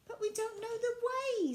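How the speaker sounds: high, anxious little protest; worried doll voice. Acted. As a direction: fret that they'll get lost. Violet worried about getting lost.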